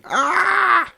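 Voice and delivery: sharp high pained cry; a high yelp of pain as the contraction hits. A narrator's reading. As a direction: yelp out in sudden labor pain. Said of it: "doubling over in pain".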